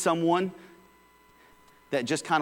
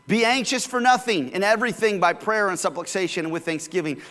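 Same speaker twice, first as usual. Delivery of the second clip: higher, lifted scripture reading; 4 semitones higher than usual. Acted. Pastor reading Philippians to the congregation, lifted reading voice. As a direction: read the verse out like a proclamation.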